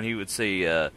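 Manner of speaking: ordinary talk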